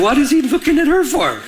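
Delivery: retold jealous outburst, pitched high; mock-indignant demand, high in the voice; upset. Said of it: "now I'm upset".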